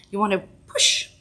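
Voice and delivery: normal talking voice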